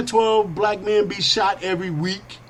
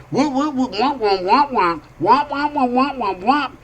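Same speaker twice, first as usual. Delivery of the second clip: high nonsense chatter; mock trash talk; 7 semitones higher than usual. Woman popping off at passing fools in higher gibberish. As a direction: rattle it off like a woman snapping back. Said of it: "she pops back off to them fools".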